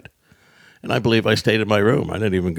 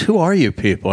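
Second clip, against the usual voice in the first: raised pitch, moderately emphatic; retold baffled question; 8 semitones higher, 3 dB louder than usual. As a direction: imitate a puzzled guard questioning them. Border guard questions the celebrities.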